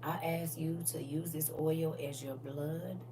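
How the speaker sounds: slow, soft prayer; softly praying over the granddaughter; quiet prayer. Shown as a demonstration. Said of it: "and say".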